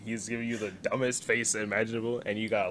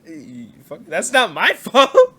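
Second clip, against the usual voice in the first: laughing protest; 6 semitones higher, 13 dB louder, slower than usual. Retold or imitated. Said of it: "and I'm like".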